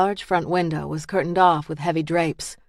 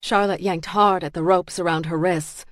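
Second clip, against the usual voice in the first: usual speaking voice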